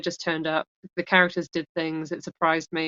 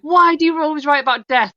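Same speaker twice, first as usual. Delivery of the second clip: higher, louder quizzical question; quizzical quote; 10 semitones higher, 7 dB louder than usual. Retold or imitated. Friend asking why she always writes about death, puzzled and loud. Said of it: "they were like".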